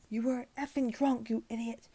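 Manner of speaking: put-on high, scornful old man's snap; a high bitter retort; snapping. A narrator's reading. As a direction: bite back with contempt. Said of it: "he snapped back at me".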